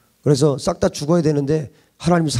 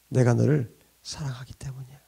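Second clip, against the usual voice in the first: whispered God's answer; 5 semitones lower, 7 dB quieter than usual. Acted.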